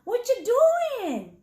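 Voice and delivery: high, slow, lightly voiced cat asking casually; cool cat asking. A narrator's reading. Novio Boy casually asking Chato what he's up to, high and slow.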